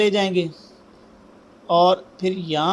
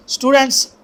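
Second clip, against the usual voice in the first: raised, higher call for attention; calling the students; 8 semitones higher, 7 dB louder than usual. Real, not acted. Teacher to calligraphy students, raised attention call. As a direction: call the class to listen up.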